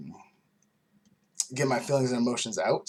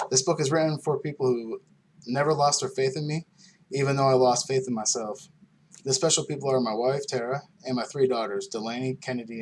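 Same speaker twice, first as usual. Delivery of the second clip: earnest book reading, slightly raised voice; reading aloud; 3 dB louder than usual. Acted. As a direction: read it like you're sharing something personal in earnest. Reads his book's dedication aloud.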